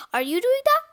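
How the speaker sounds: excited storybook query; amazed question. A narrator's reading. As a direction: ask in startled wonder. Amazed character questions friend.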